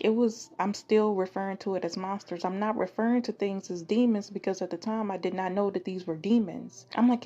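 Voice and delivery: ordinary delivery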